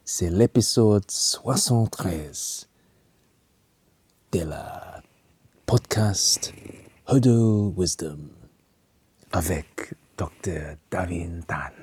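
halting, slowed French intro with a whispered end; stumbling phrasebook French, half whispered; bad French. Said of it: "in case you didn't understand my bad French".

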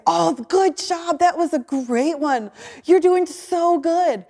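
high, slow, delighted encouragement; excited praise. Retold or imitated. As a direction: cheer her on like a proud coach. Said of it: "her excitement over my noises".

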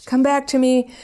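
high, coaxing siren call; luring ex. Retold or imitated. Ex baiting Martin back like a fishing hook, coaxing high. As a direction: reel him in with a sing-song lure. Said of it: "casting out with her hook".

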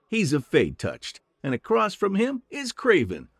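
high, eager little halfling voice; chirpy friendly halfling saying hello; cheerful halfling. Acted. Halfling chatters introductions.

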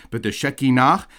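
"Shekinah" as sheh-kee-NAH, high and loud; last-syllable stress. Shown as a demonstration. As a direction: drive the final NAH of "Shekinah" upward. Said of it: "That's how you wanna do".

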